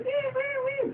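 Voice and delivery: very high soft squeaks; bunny squeak. Retold or imitated. Angel Bunny squeaking at Spike, very high. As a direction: squeak like a little rabbit. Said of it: "'cause he doesn't talk".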